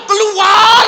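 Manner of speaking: very loud, high drawn-out scream; exorcism scream. Retold or imitated. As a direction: scream the demon out with everything. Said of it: "teriak-teriak gitu".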